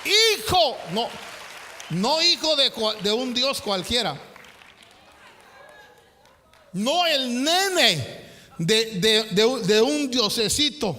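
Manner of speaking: loud, punchy pulpit declamation; preacher insisting with force; emphatic preaching. Acted. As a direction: hammer home each phrase to the church.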